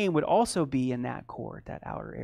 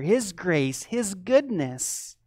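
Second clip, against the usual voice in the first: ordinary delivery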